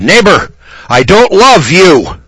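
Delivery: high, loud, slow and deliberate; booming mock-confrontation; defiant retort. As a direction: hammer each word home loudly. Retold defiant reply to preacher.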